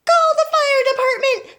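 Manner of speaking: high, loud alarmed shout; sudden shout. A narrator's reading. Grandma with burning stomach, sudden alarmed shout. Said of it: "she shouted suddenly".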